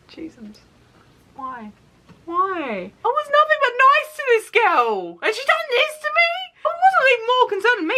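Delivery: high, strained disbelief; upset disbelief. Real, not acted. Telling viewers of the betrayal, high and strained. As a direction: voice climbing high in hurt disbelief. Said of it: "upset outburst".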